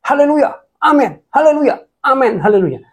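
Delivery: high, loud mock shouts of amen and haleluya; retold shouting. A congregant shouting over the pastor's sermon, high mock yells. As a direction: ham up an overeager churchgoer crying out. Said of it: "ada satu jemaat yang teriak-teriak".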